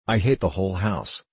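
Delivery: usual speaking voice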